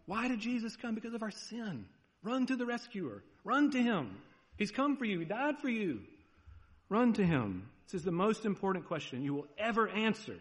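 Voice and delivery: high, pleading call to run to Jesus; impassioned preaching. Acted. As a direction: preach it at full force, urging.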